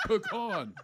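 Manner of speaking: ordinary talk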